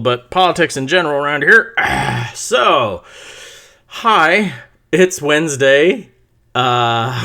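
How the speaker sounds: normal talking voice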